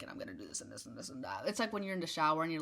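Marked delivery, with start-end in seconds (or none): 0.0-1.4 low, soft, rushed run-through; quick private rehearsal to yourself; muttered rehearsing. Retold or imitated. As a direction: rattle it off low under your breath. Mimics own muttered head rehearsal.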